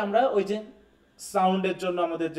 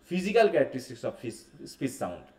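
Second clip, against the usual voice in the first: slow dictation; 4 semitones lower, much slower than usual. Shown as a demonstration. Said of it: "dictating definition".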